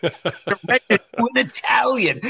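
mock outrage, spit out the word "Italian" through a laugh; Winchester spitting out ITALIAN. Fan quoting a snobbish major for fellow hosts, "Italian" spat out in mock disgust. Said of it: "He always spits out that Italian word with such inflection".

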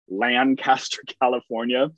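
Lancaster said LAN-CAST-er, full second syllable; California pronunciation. Shown as a demonstration. Host cites the California town, its own pronunciation. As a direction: give Lancaster a clear CAST before California. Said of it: "in California that is pronounced".

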